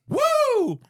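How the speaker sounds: high-pitched celebratory "woo"; sudden, very high victory cheer; triumphant whoop. Real, not acted. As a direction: whoop with pride at remembering. Celebrates remembering the name.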